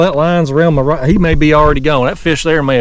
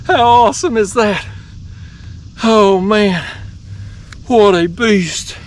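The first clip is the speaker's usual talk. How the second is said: high, delighted marvelling; thrilled awe; 6 semitones higher, slower than usual. Real, not acted. Angler admiring his flathead, thrilled and high-pitched. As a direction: marvel at it in a raised voice.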